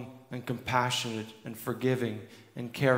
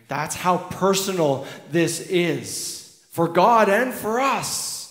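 slow, higher and louder, rising to a climax; impassioned preaching; 9 semitones higher, 6 dB louder than usual. Acted. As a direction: build the congregation up, full force. Preaching at full force.

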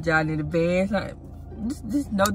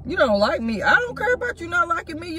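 mock outrage; 9 semitones higher than usual. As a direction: go off on her. Prank argument insult.

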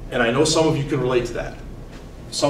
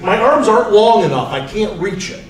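grandpa complaining; 6 semitones higher, 4 dB louder than usual. Retold or imitated. Grandfather excuse.

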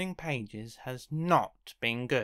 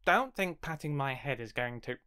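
ordinary delivery

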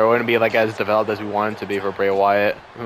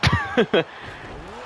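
Donald Duck squawk laugh; 3 dB quieter than usual. Pretend. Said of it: "y'all like my Donald Duck impression".

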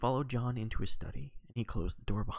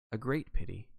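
grave. A narrator's reading. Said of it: "agreed Poirot gravely".

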